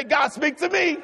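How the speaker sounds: high, emphatic shouted prayer; with conviction. Shown as a demonstration. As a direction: call it out boldly for the congregation to repeat. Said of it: "say it with some conviction".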